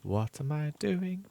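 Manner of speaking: slow, soft, exhausted; slow, tired murmur. Real, not acted. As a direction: drag it out, tired. Delirious sleep-deprived self-question.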